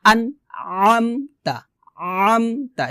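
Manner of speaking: plain "আন", then throat-squeezed "আমতা"; separated "আন আমতা" with pharyngeal ayn; pressed ayn. Shown as a demonstration. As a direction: push "আমতা" from mid-throat with effort. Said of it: "একটু কষ্ট করে উচ্চারণ".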